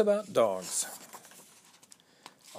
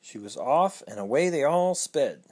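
ordinary delivery, nothing marked

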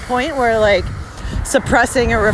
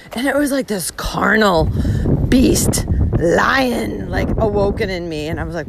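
normal talking voice

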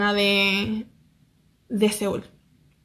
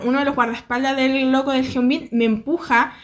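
ordinary delivery

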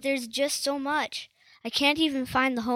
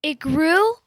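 shocked question; 4 semitones higher, 10 dB louder than usual. Acted. Shocked friend's homework grew too.

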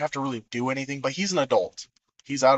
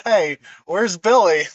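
calling out; 6 semitones higher, 7 dB louder, slower than usual. Retold or imitated. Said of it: "he goes".

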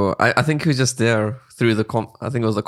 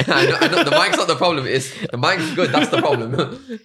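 talking through real laughter, higher pitched; real giggly laughter, voice riding high; laughing; 7 semitones higher than usual. Real, not acted. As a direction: laugh your way through the words. Laughing through a reply about the mic.

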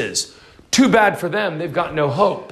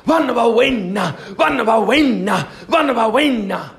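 higher, repeated chant of a name; mock shouting; 5 semitones higher than usual. Preacher imitating villagers shouting on the mountain.

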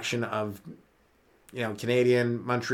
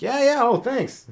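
mock pleased thanks; 10 semitones higher, slower than usual. Acted reply to being told Chicago.

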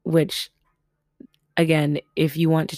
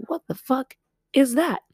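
high-pitched re-enacted disbelief; retold shock; 9 semitones higher than usual. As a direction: act out being startled, pitch jumping up. Retelling shock at sudden rumble.

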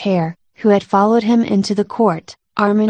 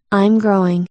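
ordinary delivery

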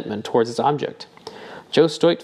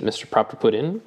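ordinary delivery, nothing marked

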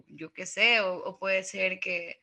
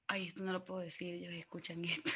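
hushed aside; 4 semitones lower, 12 dB quieter, faster than usual. Real, not acted. Fears neighbours overhear.

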